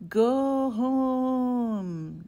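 loud, sing-song wind voice; yodeling wind. A narrator's reading. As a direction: yodel it like a gale. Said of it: "the wind yodeled".

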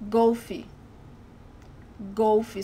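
Golfe with a closed O, ending in ee. Shown as a demonstration. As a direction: say Golfe as "GOH-fee". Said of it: "close the sound of the O".

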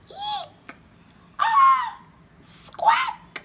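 very high squeaky animal cries; animal shrieks. A narrator's reading. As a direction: squeal like scared critters. Scared animals cry out.

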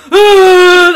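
high, very loud bawling scream; ugly crying scream. Retold or imitated. Friends describe bawling at the station, loud ugly cry. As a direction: let out a proper ugly cry. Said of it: "proper ugly crying".

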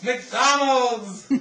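put-on high, loud excited kid shout. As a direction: cheer it like a thrilled child. Kids shouting their dinner choice.

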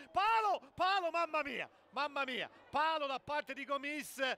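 sharp high shout, easing; excited commentary. Real, not acted. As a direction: yell at the post hit. Shot hits the post.

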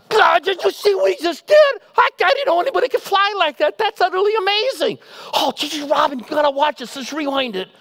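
high, loud, breathless gushing over a dunk; gushing fan. Retold or imitated. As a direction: play the starstruck fan losing it over the replay. Mock fan gushing over Kobe.

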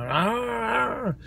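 high mock snarling "arr"; playful engine-snarl imitation; imitated car growl. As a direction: growl like a grumpy old car. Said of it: "this strange growling noise now".

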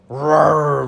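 loud drawn-out troll roar. A narrator's reading. Troll rears up at the goat, roaring. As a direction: let out a long, loud roar. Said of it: "roared the troll".

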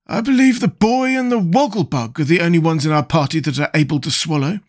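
high-pitched character voice, explaining confidently; Scarecrow, self-assured. Acted. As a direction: lecture the others in a high Scarecrow voice.

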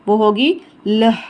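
लह said slowly with a clear breathy ह at the end; aspirated sound demo. Shown as a demonstration. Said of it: "एंड में ह की आवाज़ आनी चाहिए". (लह में आख़िर की ह साफ़ सुनाई दे)

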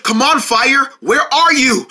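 high, urging call; pleading with fire. Acted. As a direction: coax it out between puffs of breath. Calling to the fire between puffs.

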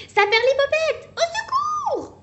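very high, loud mock panic; hammed-up little scream; panicked cry. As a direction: squeal for help like a scared child. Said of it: "crie Bébé Koala".

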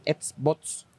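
"Xbox" mispronounced as "ets bots"; imitated mispronunciation. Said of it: "Sí, estás diciendo Xbox".

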